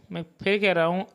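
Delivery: normal talking voice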